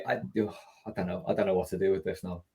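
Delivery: ordinary talk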